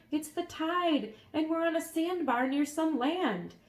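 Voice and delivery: put-on boy voice. A narrator's reading. Elmer explains.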